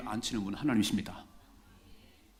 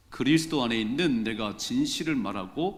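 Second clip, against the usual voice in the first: deliberate, projected recitation of scripture; scripture reading; 6 dB louder than usual. Acted. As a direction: lead the congregation in reading aloud, phrase by phrase. Leads congregation in scripture reading.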